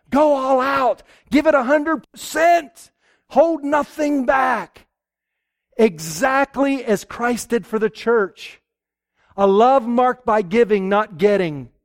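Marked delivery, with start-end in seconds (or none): 0.1-8.6 high and building to a slow shout; fervent exhortation. Acted. Preacher urges husbands, rising to a shout. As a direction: preach it out with full force.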